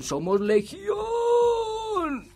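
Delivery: very high, long-drawn scream on legión; climactic shout. A narrator's reading. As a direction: belt it out and stretch the last word. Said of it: "comenzó a gritar".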